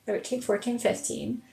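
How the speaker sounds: very soft, absent-minded count; counting to oneself. Real, not acted. Streamer tallying their plants, counting quietly to oneself. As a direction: count softly, drawing out some numbers.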